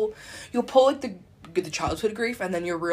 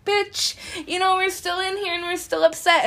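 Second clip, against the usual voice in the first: put-on high, loud child-like complaint; upset inner child; 12 semitones higher, 5 dB louder than usual. Inner child confronting adult self, upset and insistent. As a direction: pipe up as a fed-up inner child. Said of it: "that inner child who's like".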